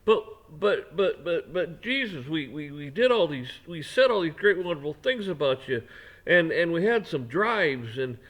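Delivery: loud, stammering mock protest; upset pleading. The judged pleading with Jesus, loud and stammering. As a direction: plead in a panic with the Lord, stuttering. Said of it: "they're gonna be upset".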